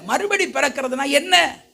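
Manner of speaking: ordinary delivery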